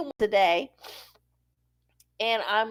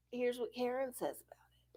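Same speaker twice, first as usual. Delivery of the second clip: softer, hushed build-up; whisper; 17 dB quieter, faster than usual. Pretend. Whispering to listeners before asking Karen. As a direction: whisper it like a secret. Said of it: "whispered lead-in to Magic Eight Ball".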